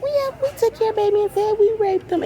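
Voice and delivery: high mocking voice full of superiority; snooty mock voice. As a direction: mimic them looking down their nose. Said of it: "walking around with that attitude like".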